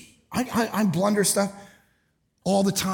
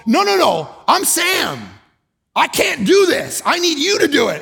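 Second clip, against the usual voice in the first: higher and louder, pleading refusal; protesting Sam; 10 semitones higher, 7 dB louder than usual. Pretend. Sam refuses Jesus's keys in a high, pleading protest. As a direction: beg out of the job.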